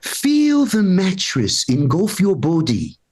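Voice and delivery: snooty upper-crust British sleep-app voice. Retold or imitated. Sleep app guides him to bed, upper crust British. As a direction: put on a posh British guide, mock soothing. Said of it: "it's always an upper crust British voice".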